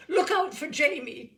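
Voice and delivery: high, loud alarmed warning; excited warning. Acted. Father warning mother about his son, loud and alarmed. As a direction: warn everyone in a fright. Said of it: "He always called me Jamie when excited".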